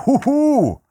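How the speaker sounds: high delighted ooh; excited squeal. Real, not acted. Real reaction to the dessert.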